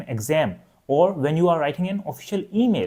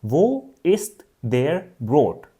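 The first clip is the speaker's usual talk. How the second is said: slow demo question; 2 semitones lower, much slower than usual. Shown as a demonstration. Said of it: "ask them the question".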